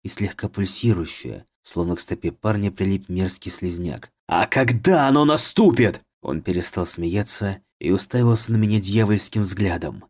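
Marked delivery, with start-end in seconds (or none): 4.3-6.0 sinister, higher, louder mocking voice; dark lord. A narrator's reading. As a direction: taunt with evil glee. Рассмеялся зловещим голосом.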